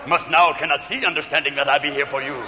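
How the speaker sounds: low, cryptic little-sage voice; Henry Kissinger as Yoda. Acted. Advisor as Jedi master instructs Ron, low cryptic croak. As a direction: intone like a puppet sage, pitched low. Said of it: "There's Henry Kissinger as Yoda".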